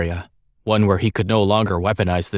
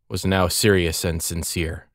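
ordinary delivery

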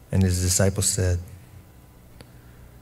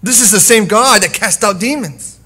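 high, loud proclamation; fiery preaching; 11 semitones higher, 12 dB louder than usual. Real, not acted. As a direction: build it to a roar. Preaching at full force.

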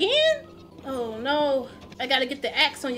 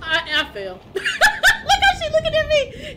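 talking through a big laugh; helpless giggles breaking into high, loud words; 11 semitones higher, 6 dB louder, slower than usual. Real, not acted. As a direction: crack up at your own failure. Laughing at own fall.

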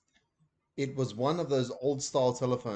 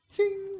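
tiny ting; 4 dB louder than usual. A narrator's reading. The phone's quietest ting as receiver goes down.

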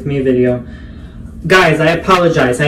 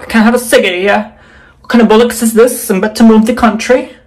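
mock Manchester accent; 4 semitones higher than usual. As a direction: rant about lockdowns as a cheeky Mancunian girl. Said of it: "people say I sound Jamaican".

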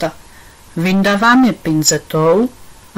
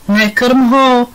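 higher, sudden protest; blurted out; 5 semitones higher, 3 dB louder than usual. A narrator's reading. Tonda stops Pipa feeding his cat, blurting it out. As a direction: blurt it out as a quick objection. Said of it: "vyhrkl Tonda".

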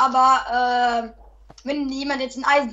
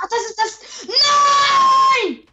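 high, loud wail of despair; anguished shout; 9 semitones higher, 4 dB louder, much slower than usual. Real, not acted. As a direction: cry out as everything is lost. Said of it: "hör auf, so rumzurasten, Alter, du übersteuerst".

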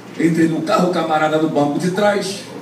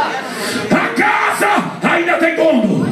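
slow preached shout; 11 semitones higher, much slower than usual. Acted. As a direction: proclaim it to the whole church. Repeated refrain, third take.

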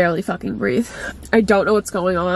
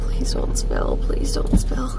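tense whisper under the breath; whispered plea; slower than usual. Real, not acted. Begging drink not to spill.

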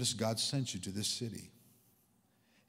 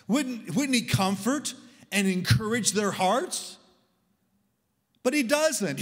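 normal talking voice

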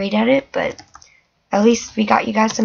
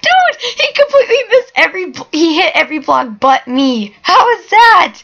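high laughing disbelief, loud; excited shriek; 11 semitones higher, 6 dB louder than usual. Real, not acted. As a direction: shriek in amazed delight. Seeker missed only his block.

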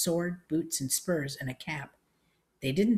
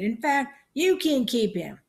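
put-on high old-lady voice; pitched-up country auntie; loud old aunt; 8 semitones higher, 5 dB louder than usual. A narrator's reading. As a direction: offer it gladly at raised pitch. Deaf aunt answers loudly.

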